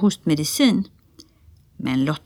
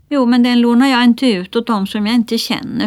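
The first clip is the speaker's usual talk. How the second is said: higher, louder pert reply; cheeky small child; 5 semitones higher, 5 dB louder than usual. A narrator's reading. Lotta answering a lady who asked about her handkerchief, cheeky. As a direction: answer back like a stubborn little girl.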